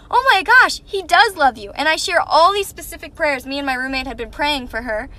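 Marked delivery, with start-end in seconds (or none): 0.1-1.7 excited. Retold or imitated. Excited reply to roommate's news.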